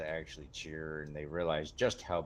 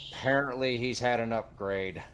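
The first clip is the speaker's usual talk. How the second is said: builds to a high, loud, drawn-out bellow of the name; announcer's big hype call; 10 semitones higher, 10 dB louder than usual. Acted. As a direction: roar the fighter's name to the crowd. Announcer hypes the fighter.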